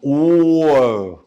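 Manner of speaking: drawn out, clear أور as a teacher's model; slow model pronunciation. Shown as a demonstration. Teacher modelling the German letter for a child, slow and clear. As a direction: stretch أور slowly for the kids to repeat. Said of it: "قول معايا".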